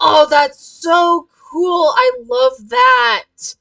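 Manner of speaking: loud, slow, drawn-out excited squeal; gushing delight. Real, not acted. Reader reacts to the game, gushing delight.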